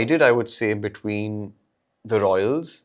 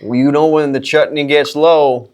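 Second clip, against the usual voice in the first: put-on Southern accent; 6 dB louder than usual. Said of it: "a little bit of Southern accent".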